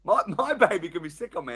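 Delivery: ordinary delivery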